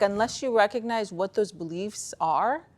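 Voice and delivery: ordinary delivery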